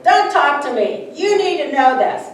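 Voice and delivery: mock mean teacher scolding a child. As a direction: bark lightly like a strict teacher. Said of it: "mean teacher".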